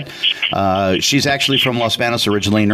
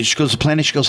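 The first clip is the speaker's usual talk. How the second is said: ordinary talk, nothing marked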